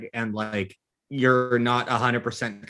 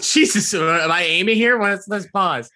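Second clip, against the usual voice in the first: laughing mock alarm; 7 semitones higher, 7 dB louder than usual. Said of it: "I was like".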